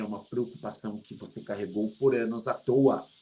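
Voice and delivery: ordinary talk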